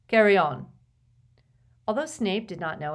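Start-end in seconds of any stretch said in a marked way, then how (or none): 0.1-0.7 loud, sharp order to the class; teacher's loud command. A narrator's reading. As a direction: bark the instruction across the room. Teacher dismissing class to work.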